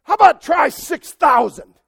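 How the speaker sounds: loud, swaggering demon taunt, slowing at the end. Acted. Demon taunting Jesus about six thousand, shouted swagger. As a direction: taunt him like a bully with an army. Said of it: "He's trying to say".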